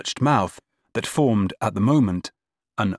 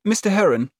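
high, loud flustered recovery; 13 semitones higher, 4 dB louder than usual. A narrator's reading. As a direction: cover the slip, high and quick. Cousin recovering, high and quick.

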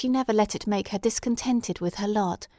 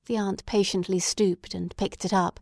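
usual speaking voice

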